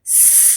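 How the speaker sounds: س as a soft hiss through the teeth; s sound. Shown as a demonstration. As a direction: let a little air hiss between the teeth. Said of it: "عندي ساوند اسمه س".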